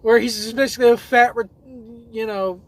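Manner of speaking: normal talking voice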